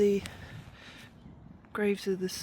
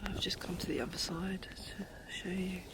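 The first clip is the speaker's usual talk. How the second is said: whisper; 7 dB quieter than usual. Real, not acted. Whispering at a war memorial.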